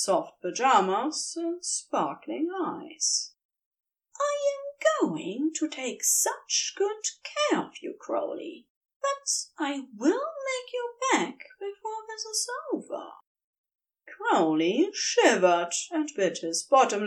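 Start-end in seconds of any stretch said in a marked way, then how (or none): 4.2-13.1 much higher, softer angel voice fading to a whisper; doting angel. A narrator's reading. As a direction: promise it tenderly, trailing into breath. Angel voiced through the scene.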